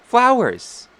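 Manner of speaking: put-on very high small-child squeal of delight; high-pitched toddler-like exclamation; little girl. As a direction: pipe up like an excited six-year-old. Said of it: "and she says".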